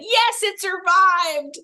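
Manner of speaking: mock relief. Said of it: "I was like".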